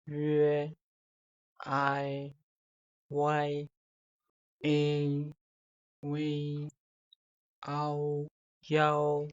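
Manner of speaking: each Chinese final said clearly and slowly for learners; Chinese finals modelled. Shown as a demonstration. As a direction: sound out each final like a teacher. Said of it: "The first one is".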